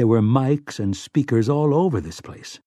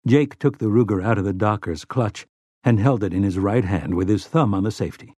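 plain reading voice

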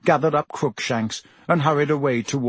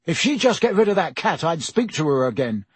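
angry; 5 semitones higher than usual. Acted. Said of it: "Ron said angrily".

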